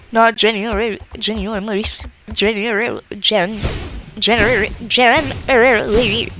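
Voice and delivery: stumbling over one word, laughing; tongue-tied giggly false starts. Real, not acted. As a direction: trip on the word and keep retrying. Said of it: "oh, I can't speak".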